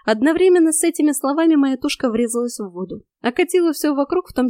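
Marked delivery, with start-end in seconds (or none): none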